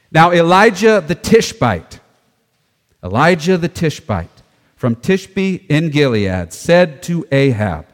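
loud, slow, emphatic Bible reading; scripture reading. Acted. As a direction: proclaim the passage deliberately. Reads scripture aloud.

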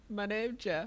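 high goofy "my name Jeff"; meme voice. Retold or imitated. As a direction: pitch it up like the meme. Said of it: "I've been doing that at work lately".

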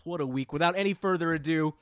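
usual speaking voice

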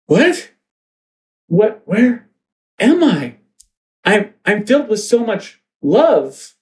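high, halting bewildered voice; dazed awakening. Acted. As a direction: wake up confused and wondering, with long pauses. Teen girls wake from trance.

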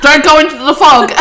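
loud mock warning.

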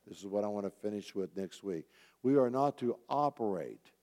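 ordinary talk

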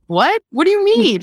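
retelling a stunned reply to mom; shocked. Retold or imitated. Daughter shocked at mom's reply, high disbelieving cry.

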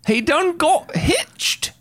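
very high, slow hillbilly voice; Southern drawl. Pretend. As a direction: stretch it out like a yokel.

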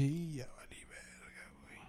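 hushed unvoiced whisper of doom; whispered dread. Thinking he's about to be robbed, whispering in dread. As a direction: breathe it out like you're done for.

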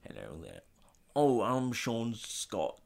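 low, slow, fumbling star impersonation; botched, drawn-out film-star greeting; Sean Connery. Acted. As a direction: drop the voice and feel for the accent. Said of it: "Connery's a tough one".

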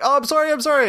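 high, hurried fake apology; mock apology. Shooter realizes you are altered, mock apologetic. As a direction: squeak out a sheepish sorry.

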